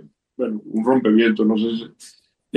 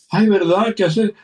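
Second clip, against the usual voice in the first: high, amazed little cry from the wife; surprised wife; 8 semitones higher than usual. Retold or imitated. Wife marveling at the dove on the car, high and surprised. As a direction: voice her delight, lifted high.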